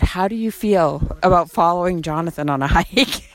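ordinary talk

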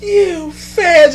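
high, cracking accusation at the crowd; Hogan accusing the fans. Retold or imitated. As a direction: Hogan calling out his own fans. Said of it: "he goes".

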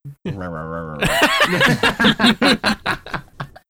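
high burst of laughter; loud laugh. Real, not acted.

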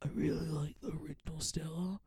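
whispered, timid mock confession; hushed retold fanboying. Fan telling a comedian which sketch he likes, whispered. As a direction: whisper it, re-enacting yourself. Shy fan quoting himself.

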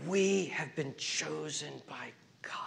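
slow, hushed whisper; intimate whisper. Real, not acted. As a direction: breathe it out like a secret blessing. Whispered affirmation of identity.

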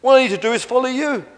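high, loud, quick eagerness; delighted realisation. Acted. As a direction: burst out eagerly with the answer. Relieved dinosaur accepts the plan.

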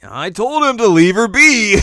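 loud, high, slow I-told-you-so; smug Moroku. Retold or imitated. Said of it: "Moroku's just like".

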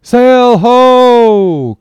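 high, ringing lookout's hail; full-voiced sailor's shout; triumphant cry. A narrator's reading. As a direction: call it out long and loud from the masthead. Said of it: "cried a triumphant voice".

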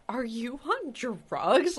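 high, drawn-out mock disbelief; retold incredulity. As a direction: imitate a stunned question, stretching each word. Retold shocked question on stage.

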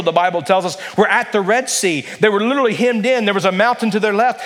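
usual speaking voice

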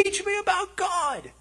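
high, soft begging voice; pleading plea. Retold or imitated. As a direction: beg to be taught, reaching up high.

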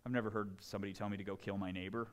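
ordinary talk

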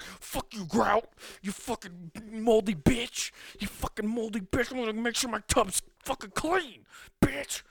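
high-pitched screeching curses at the grout; angry cleaner rant. Pretend. Pretend angry cleaner yelling at the moldy grout, hammed-up fury. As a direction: scream abuse at the grout like it wronged you. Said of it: "You're just like".

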